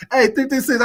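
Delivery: laughing. Real, not acted. Laughing after the retold taunt.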